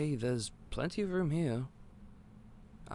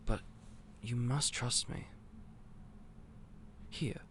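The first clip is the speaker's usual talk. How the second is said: ordinary delivery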